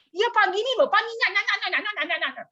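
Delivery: high, hammed-up gushing babble; mock venting to the mentor, high and excited; excited chatter.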